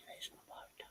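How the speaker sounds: a hushed conspiratorial whisper. Real, not acted. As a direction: breathe it out as a secret. Whispered aside.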